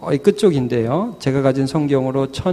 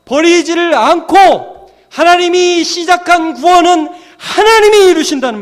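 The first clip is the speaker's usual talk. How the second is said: impassioned preaching; 7 dB louder than usual. Real, not acted. Preacher telling congregation God finishes salvation, impassioned. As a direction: build to a high, loud proclamation.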